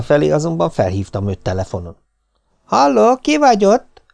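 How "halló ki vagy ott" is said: high, loud telephone holler; blaring Róza. A narrator's reading. Maid answers the master's phone call, blaring and high. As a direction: blare it down the line on a high held pitch. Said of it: "harsant Róza hangja".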